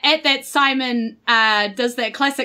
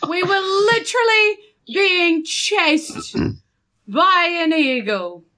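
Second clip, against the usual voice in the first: higher, drawn-out exasperated protest; indignant protest; 6 semitones higher than usual. Acted. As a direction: protest slowly, word by word. [name] indignantly retorts to boasting Jeffrey.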